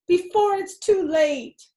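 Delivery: high, loud, slowed urgent demand; dramatic plea. Acted. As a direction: overact the emergency. Fox dramatizing.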